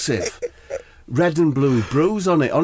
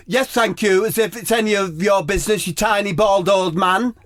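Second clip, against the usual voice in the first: high, haughty, sing-song put-down; a higher, sneering voice looking down his nose; snooty Harry Kane; 8 semitones higher than usual. Acted. Harry Kane snaps at nosy narrator.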